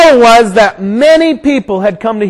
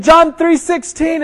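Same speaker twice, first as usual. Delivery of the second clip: high-pitched urgent reciting; acted shouting; 7 semitones higher than usual. Rider quoting verses at runaway dogs, high urgent call.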